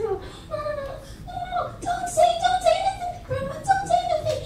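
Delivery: very high, rapid acted begging; panicked pleading. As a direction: beg frantically in a squeaky high voice. Brother begs sister not to tell.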